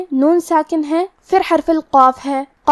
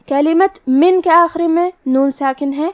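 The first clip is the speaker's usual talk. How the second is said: usual speaking voice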